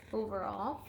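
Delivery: ordinary delivery, nothing marked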